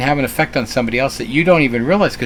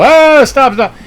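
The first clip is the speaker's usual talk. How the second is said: high loud mock yell at a car; imitated scream; 12 semitones higher, 7 dB louder, slower than usual. Member yelling at a car that won't stop, mock scream. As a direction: mimic a member yelling at drivers. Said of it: "Starts screaming at him".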